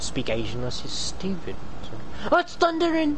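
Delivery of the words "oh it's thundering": sudden high-pitched exclamation; startled cry. Real, not acted. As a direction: yelp at the thunder. Startled by thunder in the game.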